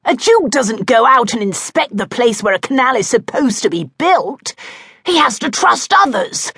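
defensive protest. A narrator's reading. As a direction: bluster your way through the excuse. Duke defends himself.

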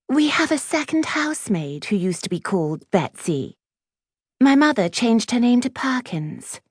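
high-pitched haughty schoolgirl; prim, high-voiced put-down; snobbish Clementine. A narrator's reading. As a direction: look down your nose in a high voice.

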